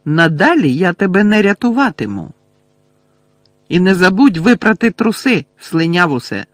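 high, loud hurt fury; Lily furious at Snape for the insult; angry retort. A narrator's reading. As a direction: spit the words at him. Lily angrily rejects Snape.

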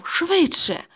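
high-pitched call to hurry; acted urging cry on the run; urgent call. A narrator's reading. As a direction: call out sharply to hurry someone along. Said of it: "тільки гукав".

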